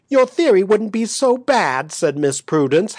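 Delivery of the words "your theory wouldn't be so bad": high-pitched, mildly hysterical; lightly acted tearful amusement; half laughing, half crying. A narrator's reading. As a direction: voice pitched up between laughter and tears. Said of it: "half laughing and half crying".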